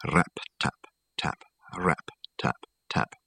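soft whispered tapping; whispered taps. A narrator's reading. Narrator whispers the tapping at the window. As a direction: whisper the raps like knuckles on glass.

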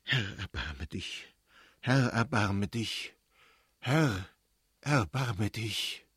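very slow, heavy plea; solemn, fearful appeal to God; prayer in dread. A narrator's reading. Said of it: "mit Grauen vor einer ungewissen Zukunft".

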